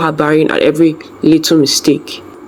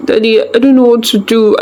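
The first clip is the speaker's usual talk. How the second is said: higher, tearful plea; crying; 6 semitones higher than usual. A narrator's reading. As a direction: plead through tears. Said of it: "she cried".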